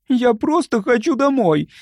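high, plaintive little voice; understated sob, pitched right up; tearful. A narrator's reading. Homesick young woman wanting to go home, tearful. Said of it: "всхлипнула Любочка".

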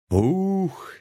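high exhale of relief; old man done with the heavy lifting; relieved ooh. Acted. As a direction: let out a big high puff of relief. Relief after lifting pot.

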